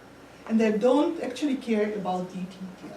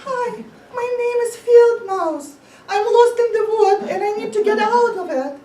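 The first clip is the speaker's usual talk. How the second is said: frantic mouse caller; 9 semitones higher, 8 dB louder, faster than usual. Acted. Mouse calls customer support.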